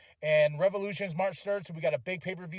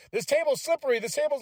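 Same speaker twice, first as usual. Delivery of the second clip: mock commentator alarm; 7 semitones higher, 4 dB louder than usual. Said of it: "the commentators are like".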